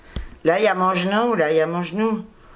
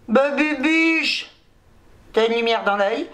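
pet talk; 3 semitones higher, slower than usual. Real, not acted. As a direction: drawl the pet name out tenderly. Parle tendrement à la chatte. (voix de bébé très lente pour la chatte)

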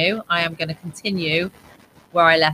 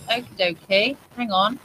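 usual speaking voice

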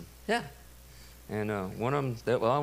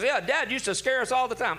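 imitated daughter; 10 semitones higher, 3 dB louder than usual. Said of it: "She says".

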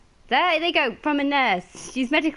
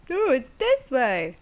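mock chat backseater; slower than usual. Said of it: "without someone saying".

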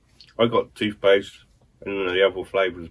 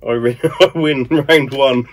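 laughing gloat; 5 semitones higher, 10 dB louder, slower than usual. Real, not acted. Gloating after winning round.

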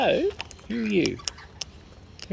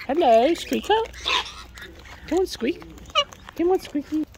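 high pet-talk coo; 11 semitones higher, 3 dB louder than usual. Real, not acted. Cooing to pet bird.

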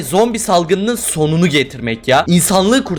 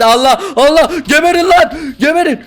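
high, loud yelling at zombies; panicked shout; 12 semitones higher, 4 dB louder than usual. Real, not acted. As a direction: scream at the horde in panic. Zombies attacking, player panics. (panik içinde bağırma)

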